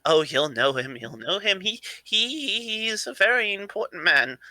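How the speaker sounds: lightly voiced eager grandson, rising as he goes; excitable eccentric grandson. Plays the same character.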